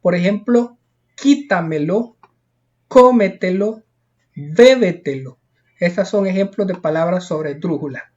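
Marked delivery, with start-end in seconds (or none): 1.1-5.4 spaced examples quítamelo, cómetelo, bébetelo, strong starts; first-syllable stress. Shown as a demonstration. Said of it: "la mayor fuerza de voz".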